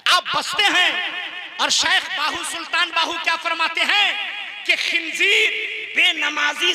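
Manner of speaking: high, loud rallying cry; fiery sermon building to a shouted vow; full-force preaching. Real, not acted. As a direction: exhort the crowd at the top of your voice.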